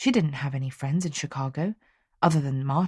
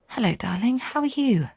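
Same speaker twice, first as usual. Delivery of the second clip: in a rush; 8 semitones higher than usual. A narrator's reading. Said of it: "asked Clara in a rush".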